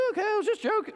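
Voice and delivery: very high squeaky mock panic; mock backpedalling. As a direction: back down in a tiny high voice, as a joke. Imitates curser nervously backing down.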